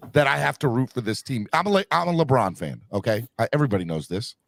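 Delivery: ordinary talk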